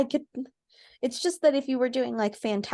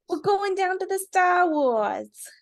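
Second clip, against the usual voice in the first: put-on Boston accent; 9 semitones higher, 5 dB louder, slower than usual. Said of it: "That's still Boston".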